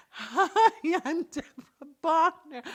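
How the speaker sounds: high, loud, laughing silly greeting; goofy loud introduction. Pretend. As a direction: ham it up again, laughing. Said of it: "I made her laugh".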